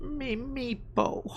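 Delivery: high, soft, slow little creature; kobold voice. Acted. Kobold telling the adventurer his name, small high voice. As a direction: squeak it out slowly and softly as a kobold. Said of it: "the kobold replies".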